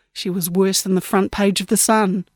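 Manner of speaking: plain reading voice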